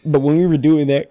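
ordinary delivery

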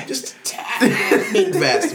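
high, slow, through laughter; laughing. Real, not acted. Gamer laughing at the tiny bonus, high and slow. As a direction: giggle the words out.

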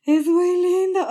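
high, loud, drawn-out coo; gushing. Retold or imitated. Fan reacting to Jean Valjean's kind gesture, gushing. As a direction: coo it with exaggerated tenderness.

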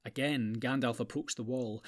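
ordinary delivery, nothing marked

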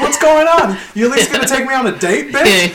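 high-pitched sarcastic goading; retold going off. Worker taunting his boss, high mock indignation. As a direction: needle him, pitch well up. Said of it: "start going off on him".

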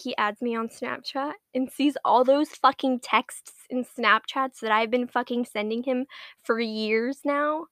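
normal talking voice

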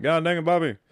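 hint of a drawling Texas dad complaining; lightly voiced Hank Hill rant. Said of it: "Hank Hill".

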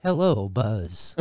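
low, slow "Hello, Buzz"; deep drawn-out cartoon cat. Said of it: "That wasn't bad".

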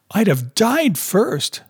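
high, slow emphatic cry; impassioned cry. A narrator's reading. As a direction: cry it out, stretching the words. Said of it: "Madge cried".

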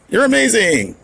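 squeaky high, excited compliment; high gushing praise. Real, not acted. Caller to host, gushing high and excited. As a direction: gush it up high.

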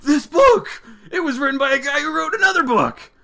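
high, loud hammed-up outburst; overexcited dad. Dad telling his unimpressed son about a book, overexcited. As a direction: gush in amazement, voice way up.

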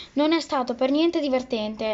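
usual speaking voice

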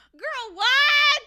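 very high, very loud hammed-up disbelief; mock incredulous shriek. Imitating a reaction to the teacher's major, mock incredulous yell. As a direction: blurt it out way up high, playfully appalled.

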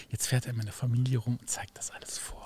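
low, unvoiced gossip whisper; hushed whisper about family; whispered aside. Real, not acted. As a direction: whisper it like a secret.

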